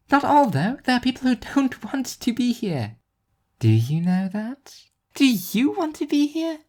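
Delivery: put-on a teenager defending people who want out; high teen girl voice. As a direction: Nadine arguing, high and defensive. Teen girl argues back.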